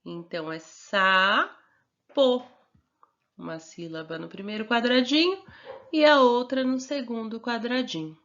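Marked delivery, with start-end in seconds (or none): 0.6-2.5 "sapo" split into a long "sa", then "po"; drawn-out teaching demo of "sapo"; slow syllable-by-syllable. Shown as a demonstration. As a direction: sound out "sapo" in two separate beats. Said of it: "duas sílabas".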